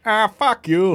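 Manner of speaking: lightly voiced Boston cartoon dad; Peter Griffin voice. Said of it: "sounds like Peter Griffin".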